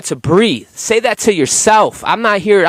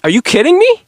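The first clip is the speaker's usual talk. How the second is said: incredulous; 11 semitones higher, 3 dB louder than usual. Real, not acted. Incredulous rant to viewers.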